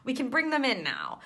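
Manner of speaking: imitating relieved guides, high and loud; cheery mock relief. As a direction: play the spirits, delighted to finally send them. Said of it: "they're like".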